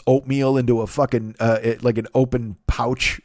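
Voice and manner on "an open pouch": normal talking voice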